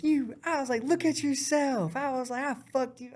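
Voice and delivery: high mocking taunt voice; high sneering taunt. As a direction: jeer at him from above.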